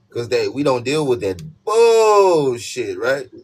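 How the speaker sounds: high, loud, slow hammed-up refusal; big hammed-up loud refusal; exaggerated. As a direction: stretch out the last word loud and high.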